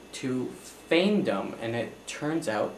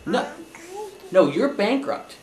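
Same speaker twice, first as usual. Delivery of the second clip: loud, higher indignant protest; indignant outburst; 5 semitones higher, 5 dB louder than usual. Acted. Fired accountant protests loudly to his bankrupt client. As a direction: fling it back at her, offended.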